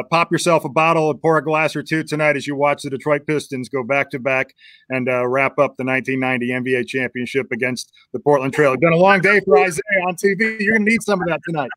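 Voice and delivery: ordinary talk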